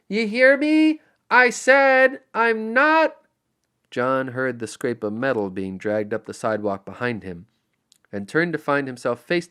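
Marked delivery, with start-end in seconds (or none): 0.1-3.2 much higher, loud defiant yell. Acted. John yelling defiance up at the trees: a loud yell. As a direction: bellow it at the sky. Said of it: "yelled up towards the skeletal tree".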